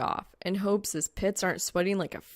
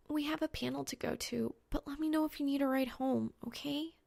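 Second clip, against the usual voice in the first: put-on higher, soft anxious girl voice; worried girl; 7 semitones higher, 6 dB quieter than usual. Worried friend offering a ride home, higher and softer. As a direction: fret gently as the friend.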